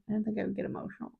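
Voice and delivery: rattled off softly and fast, under the breath; quick quiet mutter. Real, not acted. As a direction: say it again to yourself, fast and hushed. Repeats line, second time muttered.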